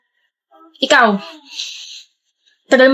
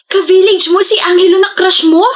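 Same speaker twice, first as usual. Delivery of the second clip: higher, excited shout; teasing shout; 7 semitones higher than usual. Acted. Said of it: "isigaw mo pa".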